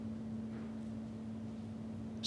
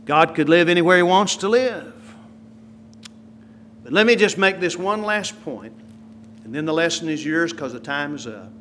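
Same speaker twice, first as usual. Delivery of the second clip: ordinary talk, nothing marked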